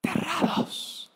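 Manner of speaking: high, breathy terrified cry, whispered; terrified gasp. Acted. Preacher acting the disciples' terror on the water, terrified cry. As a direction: gasp the word out in terror, slowly.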